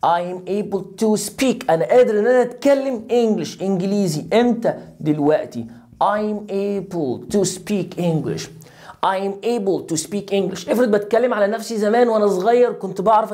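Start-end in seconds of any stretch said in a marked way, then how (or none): 0.1-1.7 very slow, even I'm able to speak; teacher dictating slowly; slow model sentence. Shown as a demonstration. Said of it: "قول". 6.0-10.7 very slow I'm able to speak English; teacher drilling a sentence. Shown as a demonstration.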